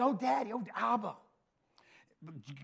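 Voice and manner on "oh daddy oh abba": put-on child calling out. Acting a child calling father.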